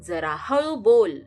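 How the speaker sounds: ordinary delivery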